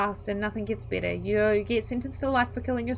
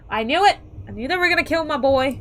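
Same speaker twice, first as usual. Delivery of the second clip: loud, raised-pitch indignant cry; mock outraged outburst; 4 semitones higher, 8 dB louder than usual. Player reacting as parent to Mark's death, mock outraged cry. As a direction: burst out like a wronged parent, hammed-up.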